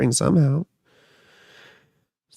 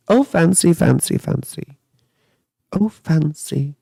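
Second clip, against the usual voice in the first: playful tease; 3 semitones higher than usual. Pretend. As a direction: tease the word fancy playfully. Teasing repeat of the comment.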